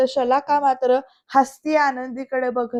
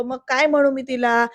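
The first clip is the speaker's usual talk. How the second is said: usual speaking voice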